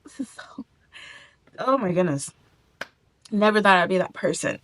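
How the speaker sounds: fighting tears, voice breaking to a whisper; holding back tears. Real, not acted. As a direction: try not to cry on camera, barely getting the words out. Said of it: "I'm also trying not to cry".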